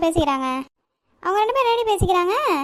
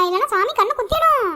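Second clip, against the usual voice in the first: ordinary delivery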